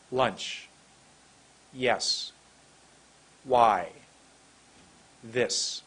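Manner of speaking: one word at a time, long pauses between; deliberate, drawn-out single words; slow word list. Shown as a demonstration. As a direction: isolate each word and let its vowel run long. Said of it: "I'll say them again".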